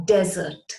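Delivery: "desert" stressed on the first syllable, DEZ-ert. Shown as a demonstration. Teacher introduces the first word, "desert" said as DEZ-ert. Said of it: "So what is a desert pronounced".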